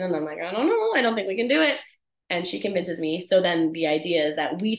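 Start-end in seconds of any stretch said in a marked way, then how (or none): 0.4-1.8 high-pitched, reluctant protest; lightly acted doubt, pitch jumping up; reticent. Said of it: "And I am reticent".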